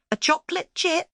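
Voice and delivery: put-on high, excited child voice; surprised discovery. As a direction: she just spotted the clue. Tia finds a clue.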